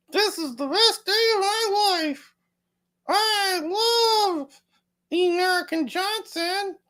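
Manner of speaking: very high, monotone puppet voice; slow, squeaky-high mock greeting, stretched out on one held pitch; Luna puppet bit. Host puppeting off-camera friend who praises him, high silly monotone. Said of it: "let's do a little Weekend at Bernie's".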